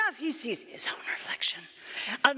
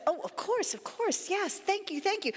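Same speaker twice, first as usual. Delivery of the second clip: higher-pitched, eager agreement; eager gratitude; 7 semitones higher than usual. Acted. Animals thanking the lion for the deal, eagerly grateful.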